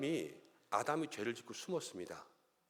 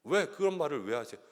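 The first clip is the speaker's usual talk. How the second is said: normal talking voice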